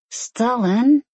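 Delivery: high loud cry of his name; cried out. A narrator's reading. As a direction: call his name out sharply. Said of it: "she cried".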